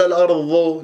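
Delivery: الأرض ending in an emphatic dh sound; dad turned to za. Shown as a demonstration. Teacher warns students, showing الأرض with ظ. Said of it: "لا تجعلها الضاء".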